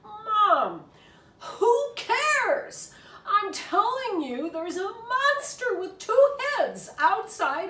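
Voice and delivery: high, loud and urgent pleading; frantic pleading. Acted. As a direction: beg Mom to come see, no patience. Child urging mother frantically.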